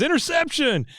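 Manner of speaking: stretched, high-pitched announcer shout; excited game announcer. Retold or imitated. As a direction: imitate an announcer crying out a big play. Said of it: "he says".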